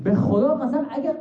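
high, rising indignant cry; cried out. Acted. Ali Akbar rebuking the Syrian soldier, rising indignant cry. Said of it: "فریاد زد که".

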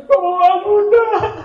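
high, tearful little plea; crying child. Acted. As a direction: plead through mock tears like a small child.

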